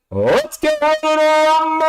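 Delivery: high, loud, slow Michael Buffer call; big arena intro, rumble held long; ring announcer impression. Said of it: "That guy, Michael Buffer?".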